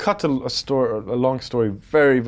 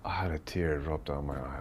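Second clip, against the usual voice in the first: whisper; 8 semitones lower, 12 dB quieter than usual. Real, not acted. Moved recalling the approval.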